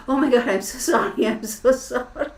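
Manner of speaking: high, loud imitation of a flustered apology; mortified apology. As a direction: gush apologies, breaking into a laugh. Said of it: "She was so embarrassed".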